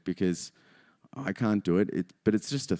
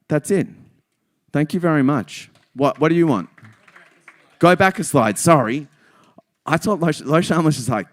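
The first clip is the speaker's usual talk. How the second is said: usual speaking voice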